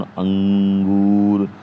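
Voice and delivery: अंगूर said very slowly, the nasal ं drawn out; nasal demo. Shown as a demonstration. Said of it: "अंगूर लिखेंगे". (अंगूर को धीरे, नाक से अनुस्वार खींचकर बोलें)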